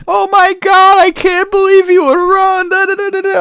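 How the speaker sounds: high, loud mock-upset girly voice; imitating upset girlfriends; upset girls. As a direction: squeal it like shocked friends.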